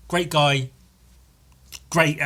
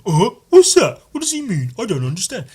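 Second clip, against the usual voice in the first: lightly voiced high confused viewer; confused American; 8 semitones higher, 8 dB louder than usual. Mocking American viewers, mildly high and baffled. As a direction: mimic a baffled listener. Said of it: "scratch their heads going".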